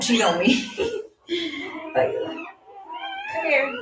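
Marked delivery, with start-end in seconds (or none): 1.9-3.7 high coaxing pet voice. Real, not acted. Coaxing her dog over.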